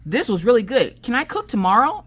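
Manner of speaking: put-on high-pitched excited kid voice; imitated eager child. Daughter asks to cook tomorrow, eagerly imitated. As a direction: ask eagerly, rising high at the end.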